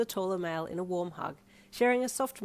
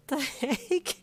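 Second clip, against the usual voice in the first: laughing; 9 semitones higher, 4 dB louder than usual. A narrator's reading. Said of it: "you laughed".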